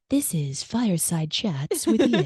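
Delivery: slow, teasing imitation of a host intro; mock podcast-host voice. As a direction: mimic a cosy fireside host, drawn out. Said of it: "you drop into this very particular voice".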